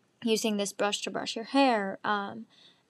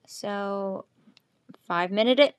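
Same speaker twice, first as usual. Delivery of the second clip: usual speaking voice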